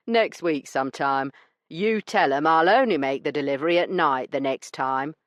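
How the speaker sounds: a smug weasel of a man brushing her off; rough dealer. Acted. Branson dismissing Io's complaint, smug and rough.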